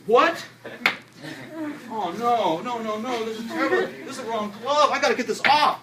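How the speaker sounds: high, loud hammed-up alarm; mock panic. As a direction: play up the horror of grabbing the wrong glove. Fake panic over wrong glove.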